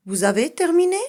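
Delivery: high, loud rising question; rising question tune. Shown as a demonstration. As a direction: lift the end like asking the class. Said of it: "je vais changer mon intonation". (intonation interrogative très montante)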